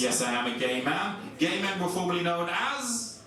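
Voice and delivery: ordinary talk, nothing marked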